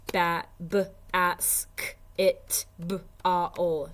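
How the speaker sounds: each sound of basketball said apart, loud. Shown as a demonstration. Teacher showing the wrong way kids clap. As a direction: chop the word into its single sounds. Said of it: "if you were to clap every sound".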